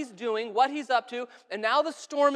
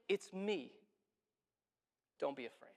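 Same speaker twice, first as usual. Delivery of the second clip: softly, much quieter, calming frightened friends; gentle reassurance; 6 semitones lower, 13 dB quieter than usual. Acted. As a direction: hush it like soothing someone terrified. Jesus calming terrified disciples.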